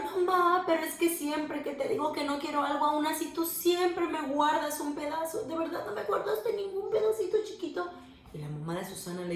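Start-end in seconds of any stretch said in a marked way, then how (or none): 0.0-7.9 whiny plea. Acted. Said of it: "Empezó a llorar".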